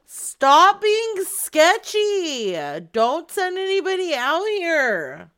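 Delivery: loud, slow high yell; shouting. Real, not acted. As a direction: call out sharply to her. Yelling at the old lady.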